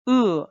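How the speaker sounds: "Uh" high, loud and falling; falling fourth tone. Shown as a demonstration. Teacher demonstrates fourth tone "Uh" to students, falling sharply. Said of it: "So read after me".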